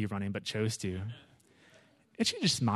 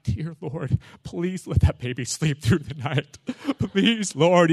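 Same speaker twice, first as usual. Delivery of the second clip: laughing plea; 2 semitones higher, slower than usual. Retold or imitated. Begging for baby sleep.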